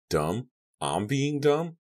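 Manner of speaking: high, rising disbelief; incredulous retort. A narrator's reading. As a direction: throw it back as an outraged question. Captive throws insult back.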